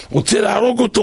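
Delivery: ordinary talk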